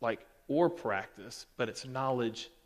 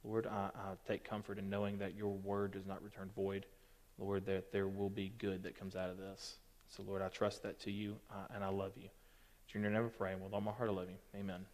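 soft, hushed prayer voice; 4 semitones lower, 10 dB quieter than usual. Real, not acted. Youth leader praying softly before the lesson, hushed prayer. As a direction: pray quietly and earnestly, eyes closed.

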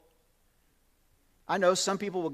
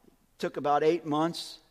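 ordinary talk, nothing marked

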